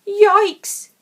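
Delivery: high loud alarmed cry; startled yikes. A narrator's reading. Narrator startled as the puppy bursts out, alarmed. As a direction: jolt with alarm.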